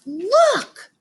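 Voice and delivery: sudden high excited cry; excited shout. Acted. A child spotting the butterfly, shouting excitedly. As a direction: call the class over with a yelp. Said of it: "someone shouts".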